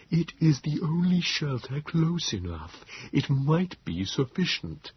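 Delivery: high, slow, feeble creature voice; Nebogipfel. A narrator's reading. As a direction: plead weakly, spacing out the words. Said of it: "such pleading".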